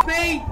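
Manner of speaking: high loud startled gasp; gasp, then yelp in fright; panicking gamer. Real, not acted. Jump scare in horror game.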